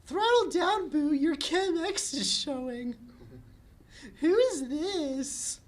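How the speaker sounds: put-on very high, bright girly voice; squeaky cheerful sister; Bubbles voice. Bubbles voiced high in table read.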